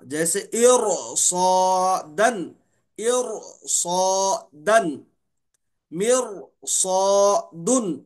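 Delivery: इरसादन slow, ra said thick and full; heavy ra. Shown as a demonstration. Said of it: "हम पढ़ेंगे रॉ को मोटा". (रॉ को मोटा, धीरे से)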